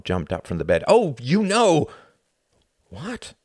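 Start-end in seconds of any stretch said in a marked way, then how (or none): 0.9-1.9 loud, high, drawn-out scorn; angry retort. Acted. Tom jumping up from the bed at his friend, angry. 2.9-3.3 small, soft, high rising question; bewildered. Acted. Hart answering his angry friend, meek and bewildered.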